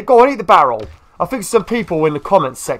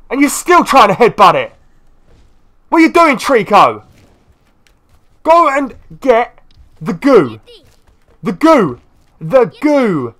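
exasperated yelling; 7 semitones higher, 6 dB louder, slower than usual. Real, not acted. Yelling commands at Trico.

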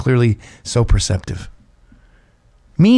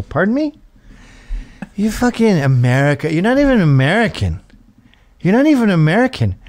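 put-on drunken argument; 5 semitones higher than usual. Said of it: "I go".

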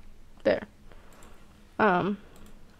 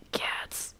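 excited gasp, then whispered delight; tiny whispered thrill; hushed excitement; 11 dB quieter than usual. Real, not acted. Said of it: "I'm getting excited over nothing".

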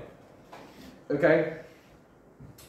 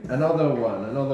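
louder call of another one; loud prompt; 3 semitones higher, 7 dB louder than usual. Real, not acted. Teacher calling the class to the next word, loud. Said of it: "teacher prompts class".

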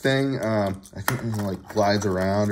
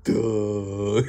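dopey toy voice. Pretend. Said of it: "he's like".

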